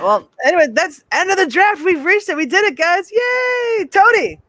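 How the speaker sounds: high, loud, cheerful host announcement; excited wrap-up. Real, not acted. Host to panel at draft end, high excited cheer. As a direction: cheer the finish of the draft with a shouted yay.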